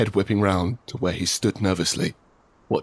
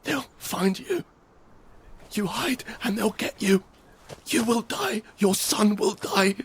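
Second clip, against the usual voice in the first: hushed threat; 4 dB quieter than usual. Acted.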